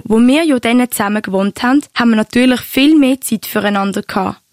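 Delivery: ordinary delivery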